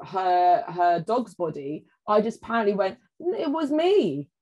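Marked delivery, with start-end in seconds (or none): 3.2-4.3 high, drawn-out little voice; child's confession. Retold or imitated. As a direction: mimic an over-eager child owning up. Child self blurting a false confession.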